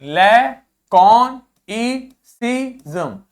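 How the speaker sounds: लैकोनिसिज्म stretched out syllable by syllable; very slow model pronunciation. Shown as a demonstration. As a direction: drag out लैकोनिसिज्म for the class. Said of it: "अगला देखो". (बहुत धीमा उच्चारण)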